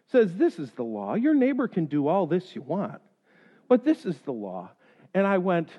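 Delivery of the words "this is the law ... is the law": low, soft firm police officer; officer firmly overruling the neighbor, low; stern officer. Retold or imitated. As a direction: lay down the law, low and steady. Officer stating law firmly.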